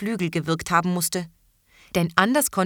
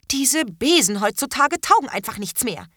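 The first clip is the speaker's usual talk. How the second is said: grumbling; 5 semitones higher, 4 dB louder than usual. A narrator's reading. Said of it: "schimpfte Terras Mutter".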